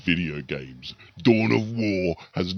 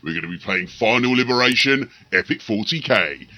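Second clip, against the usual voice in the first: lightly grand announcer voice, a bit louder; epic narrator intro; 4 dB louder than usual. Acted. YouTuber introducing his battle report, mildly epic announcer. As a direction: open the video like a trailer narrator.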